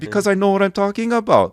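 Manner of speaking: defiant comeback. Retold or imitated. Student answering shocked classmates, loud high defiant comeback. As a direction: fire it back sure of yourself, loud and high. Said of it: "I was like".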